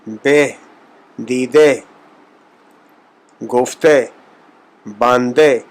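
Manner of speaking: each word ending in a front e sound; Persian e ending. Shown as a demonstration. Teacher listing Persian words ending in e. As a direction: end دیدے and گفتے on e. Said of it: "سب کا جو تلفظ ہے، وہ زیر کی طرح".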